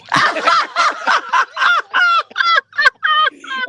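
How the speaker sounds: loud, high burst of laughter; big belly laugh. Real, not acted. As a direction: crack up long and loud. Cracks up at her teammate.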